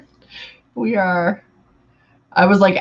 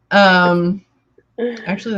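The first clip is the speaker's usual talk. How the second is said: ordinary talk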